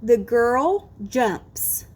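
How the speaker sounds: very slow, separated words, crisp S on jumps; clear final S. Shown as a demonstration. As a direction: draw out "girl" and "jumps" so the S is heard. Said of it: "I'll say it again".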